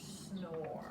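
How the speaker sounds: whispered snore; soft sleepy whisper. A narrator's reading. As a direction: breathe it out like snoring. Snore word acted out.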